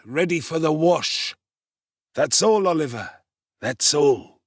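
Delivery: put-on high, wheedling old villain, fawning. A narrator's reading. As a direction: coax and cover up, chuckling.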